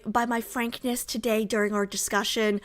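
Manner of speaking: usual speaking voice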